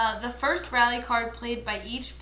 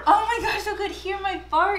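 laughing; 10 semitones higher, 8 dB louder than usual. Real, not acted. Laughing at fart joke.

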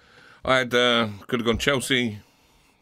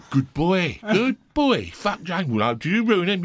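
hard men cheering; 6 semitones higher, slower than usual. Retold or imitated. Hard men praising him in pub.

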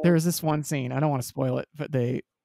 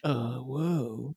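underplayed dude "whoa"; mock dazed surfer reaction; Keanu Reeves; 3 dB quieter than usual. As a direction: imitate a blank, no-reaction surprise. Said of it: "Keanu's just like".

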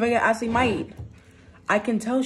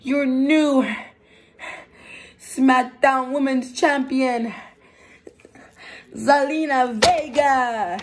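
slow, loud title announcement; announcer; 4 semitones higher, 4 dB louder, slower than usual. Pretend. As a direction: crown the new champion slowly. Announcer crowns new champion.

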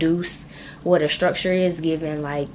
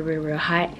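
ordinary talk

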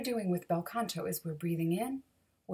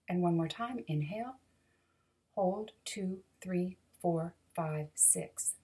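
calm counted breathing cues; breathing exercise count. Shown as a demonstration.

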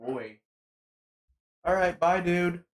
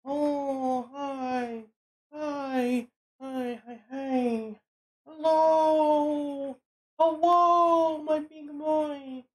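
talking to a dog; 12 semitones higher, 3 dB louder, much slower than usual. Real, not acted. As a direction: gush over the dog. Greeting dog.

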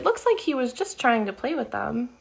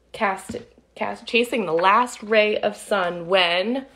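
put-on lively read-aloud for kids; storybook narration; 5 semitones lower than usual. A narrator's reading. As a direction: tell it like a picture book to children. Reading picture book aloud.

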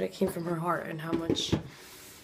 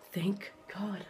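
whispered relief; 7 dB quieter than usual. Retold or imitated. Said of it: "I was like".

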